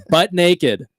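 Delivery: bursting into laughter on the punchline; laughing. Real, not acted. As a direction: laugh through the words.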